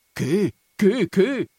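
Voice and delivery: shocked villagers; startled crowd. Acted. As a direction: gasp out in disbelief.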